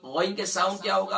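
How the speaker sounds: usual speaking voice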